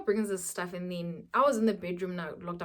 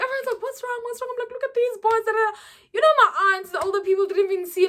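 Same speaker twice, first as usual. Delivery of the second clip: crying retelling; 11 semitones higher, 6 dB louder, faster than usual. Retold or imitated. Retelling crying over boards.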